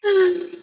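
high voiced sigh. A narrator's reading. Moth landing on a mossy rock after searching, sighing. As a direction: let out a high, drawn sigh. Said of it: "landed on a mossy rock and sighed".

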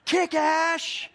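high, loud sideline yell; full-throated retold cheer; screaming. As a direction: scream it across the field to cheer him on.

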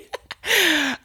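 a high burst of real laughter; sudden real laugh. Real, not acted. Joking about doing a PhD, she bursts out laughing. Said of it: "I don't know why I'm laughing".

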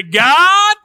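high, loud cry of God; stretched, ringing call; rousing shout. Shown as a demonstration. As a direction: shout it upward to heaven. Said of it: "you gotta say it like this".

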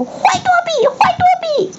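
very high elf cry; elf wailing. A narrator's reading. Dobby scolding himself, very high. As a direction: scold yourself in a squeal. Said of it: "嘴里还连连狂吼".

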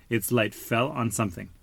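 ordinary delivery, nothing marked

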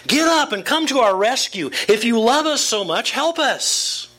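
high, urgent cry to God; impassioned psalm reading. Acted. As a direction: plead with God to wake up. Reads David's psalm as a cry.

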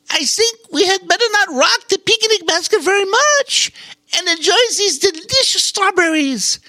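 a squirrel suggesting they sit tight; squirrel's calm advice. Acted. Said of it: "said the gray squirrel".

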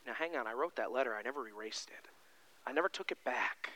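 calm a frightened friend; reassuring. Acted. Jesus reassuring Peter.

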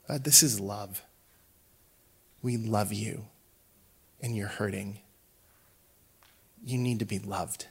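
put-on soft, gentle comfort to a hurting boy; tender reassurance. Wife comforting the crying boy.

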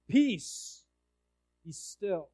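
a half shouted command to the sea. Shown as a demonstration. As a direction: call out peace, then let it drop. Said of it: "And he shouts".

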